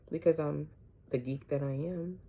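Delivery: usual speaking voice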